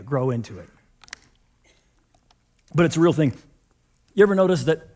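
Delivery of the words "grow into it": low, hushed repeat of the phrase; quiet emphasis. Shown as a demonstration. Pastor repeating his bottom line to the congregation, hushed. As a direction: drop the voice and let "grow into it" sink in. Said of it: "I don't know that we like that idea".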